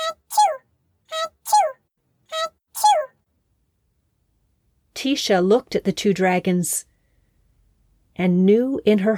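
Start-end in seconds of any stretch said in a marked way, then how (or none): 0.1-3.1 three high squeaky sneezes; dragon sneezes. Acted. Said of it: "began to sneeze itself".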